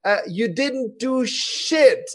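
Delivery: slow, high, loud angry yell in English; furious trainer tearing into a German attendee; retold yelling. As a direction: bellow it at him, slowly spitting each word. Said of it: "hat der mich angebrüllt".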